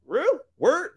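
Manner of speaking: high, surprised slangy "Real? Word?"; in character as Brandon. Retold or imitated. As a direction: pitch it up like an amazed street-talking buddy. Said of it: "look how get in character".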